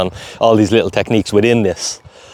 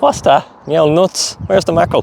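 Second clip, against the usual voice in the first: higher, lightly voiced dog; voicing the dog; 7 semitones higher than usual. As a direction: speak for the puzzled dog. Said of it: "She's like".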